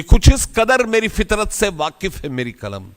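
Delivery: high, ringing first line of a couplet; poem recitation. Acted. Speaker reciting Bhagat Singh's verse to an audience, high declamation.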